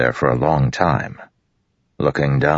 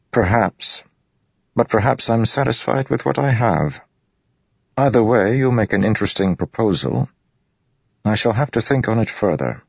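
higher-pitched, wary nobleman; noncommittal high prince; 8 semitones higher than usual. Acted. Royan weighs Dalinar's proposal, noncommittal. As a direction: put him off politely, pitched up.

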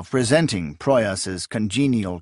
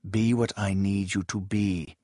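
quiet warning; 2 semitones lower, 6 dB quieter than usual. A narrator's reading. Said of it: "his eyes warned".

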